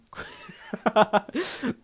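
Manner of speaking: high breathy giggle; airy laugh. Real, not acted. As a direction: burst into an airless laugh. Laughs at own pronunciation.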